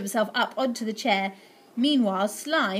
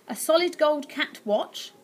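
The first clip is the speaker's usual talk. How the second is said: ordinary delivery, nothing marked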